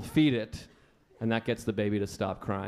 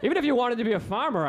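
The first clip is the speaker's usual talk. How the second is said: normal talking voice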